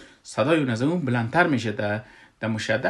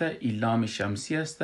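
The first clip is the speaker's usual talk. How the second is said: ordinary talk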